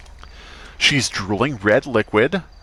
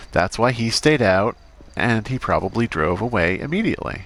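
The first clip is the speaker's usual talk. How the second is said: ordinary delivery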